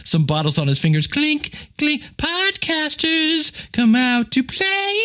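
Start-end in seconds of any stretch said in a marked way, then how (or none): 1.1-4.9 high, drawn-out sing-song taunt; creepy gang taunt. Retold or imitated. Host mimics Warriors thug taunting podcasters, high sing-song. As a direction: call them out to play, eerie and slow.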